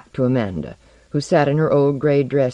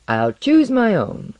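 high, slow, proud declaration; 8 semitones higher, slower than usual. A narrator's reading. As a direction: announce it proudly, drawing it out. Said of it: "said Blank proudly".

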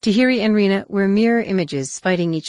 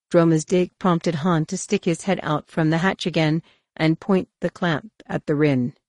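plain reading voice

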